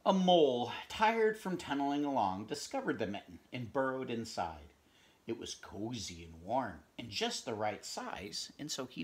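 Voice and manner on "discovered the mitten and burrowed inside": plain reading voice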